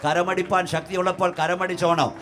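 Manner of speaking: impassioned preaching. Real, not acted. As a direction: build from forceful preaching to a high shouted command. Preaches at mounting full force. (ആവേശത്തോടെ ഉച്ചത്തിലുള്ള പ്രസംഗം)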